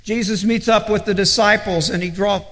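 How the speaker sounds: ordinary delivery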